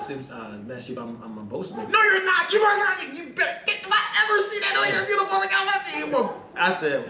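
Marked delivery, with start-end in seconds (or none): 0.0-1.9 much softer, lower stammering reply; nervous sailor mumbling to the master chief; timid stammer. Retold or imitated. Sailor stammers before angry chief. 1.9-6.4 much higher, half-laughing mock tirade; hammed-up furious chief, rushing the threat; mock yelling chief. Chief yells back at sailor.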